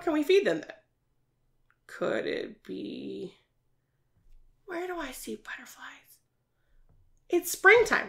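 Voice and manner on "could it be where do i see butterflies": put-on hushed whisper to draw kids in; playful teacher whisper; secret whisper.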